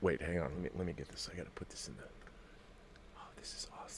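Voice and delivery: soft, fading mutter ending in a whisper; muttered to himself. Real, not acted. Alone at the computer, trailing into a whisper. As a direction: drift off into a whisper while clicking.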